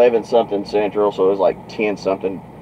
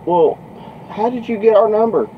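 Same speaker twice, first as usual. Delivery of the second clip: much higher, incredulous mimicry; imitated baffled father; 12 semitones higher than usual. Father asking how trucker got the number, imitated bafflement. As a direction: play a dad thrown by the call.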